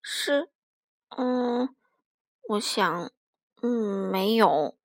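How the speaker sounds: soft, halting, with long hesitations; 结结巴巴. A narrator's reading. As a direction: falter between words, low and quiet. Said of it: "我结结巴巴地说". (吞吞吐吐地小声回答)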